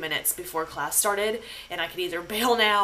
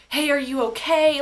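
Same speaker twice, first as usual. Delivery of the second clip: raised, louder imitation of worried friends; concerned; 6 semitones higher, 5 dB louder than usual. Friends texting to check on her, imitated concern. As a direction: check on them with urgent concern. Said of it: "they're all just super concerned".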